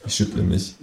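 usual speaking voice

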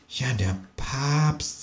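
very soft, slow, drawn-out; slow, hushed kangaroo. A narrator's reading. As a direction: breathe it quietly, with dread. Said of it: "sagt das Känguru leise".

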